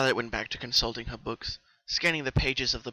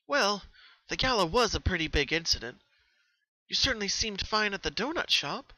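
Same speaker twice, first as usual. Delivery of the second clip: put-on higher, louder studious young mare; Twilight, studious; 7 semitones higher, 4 dB louder than usual. Twilight questioning Pinky about her dreams, studious. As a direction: ask it like a curious scholar.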